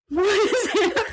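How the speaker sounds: high, laughing mock alarm; husband asking. Husband seeing her bloody face, high and laughing. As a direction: ask it high through a laugh. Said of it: "He's like".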